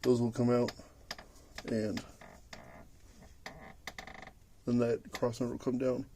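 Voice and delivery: huffing and puffing; wheezing while lying under a car; out of breath. Real, not acted. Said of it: "sorry if it sounds like I'm huffing and puffing".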